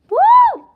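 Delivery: high shrieking whoop; loud whoop. Real, not acted. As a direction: whoop at the sourness. Reacts to sour candy.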